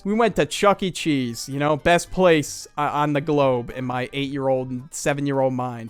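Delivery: ordinary talk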